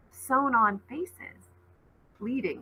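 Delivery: usual speaking voice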